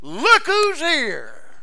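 triumphant proclamation. Acted. Preacher proclaiming Jesus to the church, triumphant. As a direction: ring it out in triumph. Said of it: "climax repeat of the phrase".

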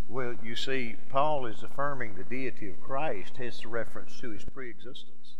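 loud, driving sermon voice; full-force preaching. Acted. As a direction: preach it hard to the congregation.

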